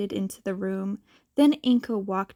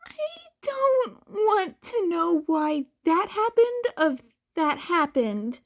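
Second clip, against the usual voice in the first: high weary voice; high tired reply; character voice; 7 semitones higher than usual. Acted. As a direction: read it wearily in character. Chat member weary reply.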